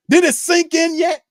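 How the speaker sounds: high, loud, needling question; loud exasperated jab. Real, not acted. Fan yelling at other fans over the losing record. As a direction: hammer it home.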